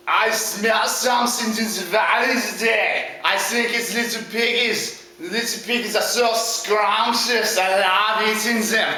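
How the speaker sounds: slow, relishing villain voice; drawn-out storybook villain; hungry big bad wolf. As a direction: savour every word like a hungry wolf.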